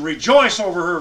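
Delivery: preacher reading a joyful verse aloud; proclaiming scripture. Acted. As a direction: proclaim it out to the room.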